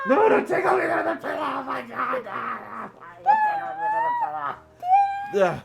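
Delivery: high squealing pleas; being tickled. Pretend. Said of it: "I've been tickle tortured".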